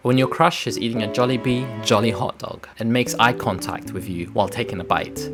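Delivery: ordinary delivery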